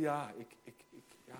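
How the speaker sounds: soft, faltering Moses stammering into a whisper; hesitant stammering under the breath; timid stammer. Retold or imitated. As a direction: shrink away, stumbling on every ik. Moses stammering, shrinking back.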